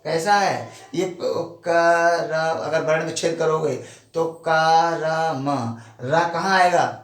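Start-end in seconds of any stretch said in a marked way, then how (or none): none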